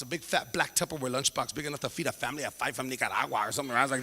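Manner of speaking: usual speaking voice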